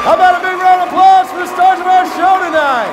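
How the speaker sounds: loud, high showman's call for applause; big showman announcement; announcer call. Real, not acted. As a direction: whip up the audience. Host calls for applause.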